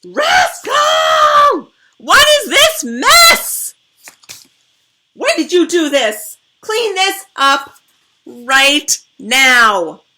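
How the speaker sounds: furious high shouting mom, slow; screaming mom. A narrator's reading. Mom screaming at her child about the giant fort, furious. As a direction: yell at the rascal, loud and high. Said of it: "Then I hear screaming".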